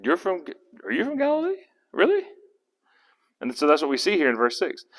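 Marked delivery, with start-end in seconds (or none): 0.0-2.2 high, halting disbelief; incredulous questioning. Acted. Pilate learning Jesus is from Galilee, astonished. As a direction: ask haltingly, voice rising way up.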